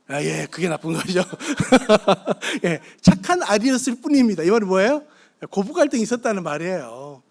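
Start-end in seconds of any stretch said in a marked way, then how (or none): 0.1-4.4 laughing. Real, not acted. Laughing at his own confession.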